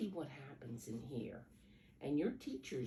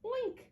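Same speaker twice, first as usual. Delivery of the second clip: high, louder oink; pig oink; 5 dB louder than usual. Acted. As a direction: squeal it like a piglet. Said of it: "says the pig".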